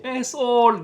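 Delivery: loud high callout; shout. Retold or imitated. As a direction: yell it like a heckler. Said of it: "qui criait".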